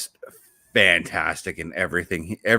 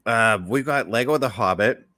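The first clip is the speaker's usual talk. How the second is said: ordinary talk, nothing marked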